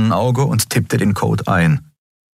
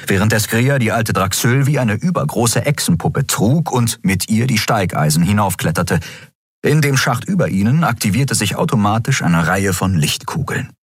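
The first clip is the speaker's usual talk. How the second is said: ordinary delivery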